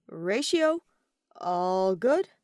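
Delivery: loud, worried call through a door. Acted. Aventurine calls to Ratio through the bathroom door, loud and worried. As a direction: raise your voice so he hears you through the door.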